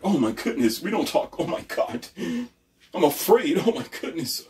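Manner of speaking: high fussy mock panic, one line breathless; flustered prim voice he mocks; mocking scared prude. Mocking the timid people who shush him, fussy panic. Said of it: "those scary people who say".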